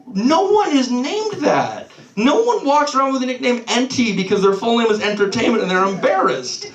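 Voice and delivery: high-pitched, exasperated rebuttal; incredulous protest. Retold or imitated. Comic answering angry brother in the kitchen, incredulous. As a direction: argue back in comic disbelief. Acted argument back at the brother.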